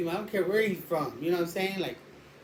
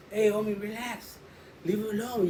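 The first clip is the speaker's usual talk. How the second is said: higher-pitched plea to back off; appeasing appeal; 4 semitones higher than usual. Retold or imitated. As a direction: talk a friend down. Retold attempt to calm a friend.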